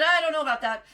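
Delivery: put-on laughing as she tells the old man no; laughing doubt. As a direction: brush it off through laughter. Repeats reply, laughing.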